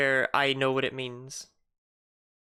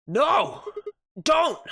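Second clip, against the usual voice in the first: high, loud cry of no; desperate shout; 14 semitones higher, 5 dB louder than usual. Acted. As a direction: yell to stop him before it's too late. Voicing Sigma, shouting to stop Dio.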